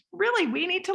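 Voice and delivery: ordinary talk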